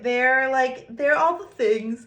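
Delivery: talking through a yawn. Real, not acted. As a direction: yawn while saying it. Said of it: "I just yawned so loud".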